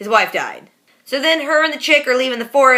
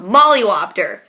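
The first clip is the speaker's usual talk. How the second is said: normal talking voice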